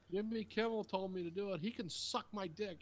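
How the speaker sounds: high-pitched mock bratty kid; defiant child impression. Kid defying mom over the TV, high mock defiance. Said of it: "It's like a ten-year-old kid".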